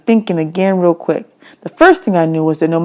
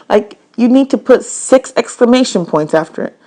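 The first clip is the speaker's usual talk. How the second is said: usual speaking voice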